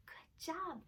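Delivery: soft whispered good job; whispered praise. Acted. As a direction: breathe the praise softly.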